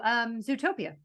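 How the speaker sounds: normal talking voice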